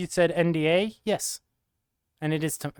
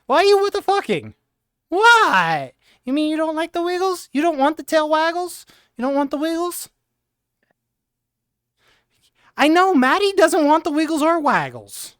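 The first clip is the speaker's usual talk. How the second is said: squeaky mock-offended teasing; high teasing voice; 10 semitones higher, 3 dB louder than usual. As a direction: pitch way up, tease the chat. Teasing chat about wiggles.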